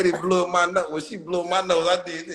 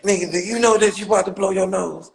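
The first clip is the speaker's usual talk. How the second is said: usual speaking voice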